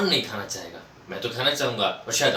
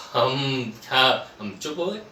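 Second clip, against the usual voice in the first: ordinary talk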